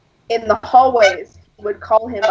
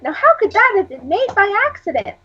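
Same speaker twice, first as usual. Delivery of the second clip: high, knowing rhetorical question; lightly mock the teacher's smug wondering; mock preachy teacher; 10 semitones higher than usual.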